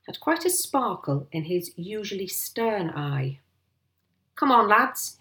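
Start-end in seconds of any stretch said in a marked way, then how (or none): 4.3-5.2 high, loud call to the men; rally everyone with gusto; hearty call to action; rallying cry. A narrator's reading. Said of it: "he cried".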